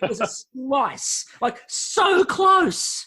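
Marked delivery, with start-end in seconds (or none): 1.4-3.0 exasperated. Real, not acted. Exasperated about Bakewell slice.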